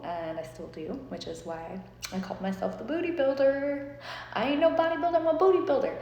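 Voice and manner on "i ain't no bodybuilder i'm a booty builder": high, loud catchphrase delivery; slogan. Shown as a demonstration. Proudly reciting her booty builder slogan, high and loud. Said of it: "That was always my slogan".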